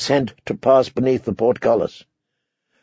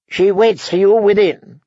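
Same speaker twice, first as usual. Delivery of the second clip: lightly voiced envoy, pitched higher; promising envoy; 7 semitones higher, 3 dB louder than usual. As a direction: give a courteous assurance in a higher voice. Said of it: "promised Edwin Frey".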